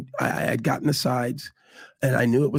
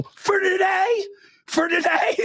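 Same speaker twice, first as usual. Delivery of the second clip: very high, incredulous retold shout; upset outburst; slower than usual. Actor re-enacts answering the studio head's announcement, upset outburst. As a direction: fling the question out high and drawn, reenacting the upset. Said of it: "'Cause I was so upset".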